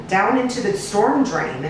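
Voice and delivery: plain reading voice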